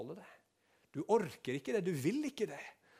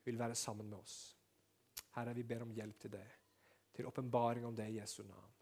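soft, prayerful voice; 4 dB quieter than usual. Acted. Pastor praying over communion for the congregation, softly. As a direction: pray humbly over the meal.

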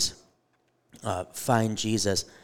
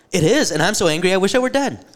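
high, loud furious outburst; angry Jonah; 10 semitones higher, 10 dB louder than usual. Acted. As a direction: blow up at God like a sulking prophet. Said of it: "And Jonah replies".

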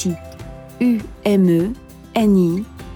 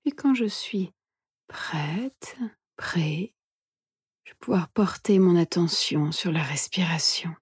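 soft, hushed meditation guide; guided meditation whisper; 5 dB quieter than usual. Acted. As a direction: whisper gently, leaving long pauses.

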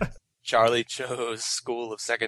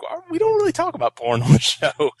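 mock prim voice; 6 dB louder, faster than usual. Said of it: "we're like".

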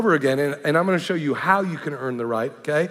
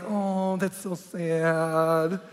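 mock sympathy; 3 dB quieter, much slower than usual. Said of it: "He thought I would be like".

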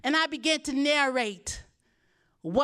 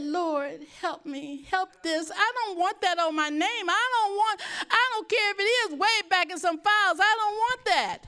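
retold prayer, pleading and a little higher; tearful prayer; 6 semitones higher than usual. Pastor retelling her crying prayer in the car, mild tearful pleading. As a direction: plead with the Lord, mildly tearful. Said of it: "So I began to cry".

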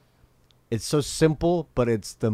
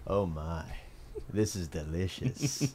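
slow, softer, lower relish; savoring Bill; 3 semitones lower, 7 dB quieter, slower than usual. Acted. Host acting Bill relishing Dave and Lisa's awkwardness, slow and savoring. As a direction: linger over it, quietly enjoying the awkwardness.

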